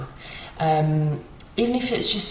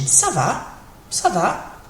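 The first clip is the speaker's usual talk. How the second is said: "Ça va" as an answer, va falling; different intonation; 3 semitones higher, slower than usual. Shown as a demonstration. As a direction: let "Ça va" come down like a reply. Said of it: "with a different intonation".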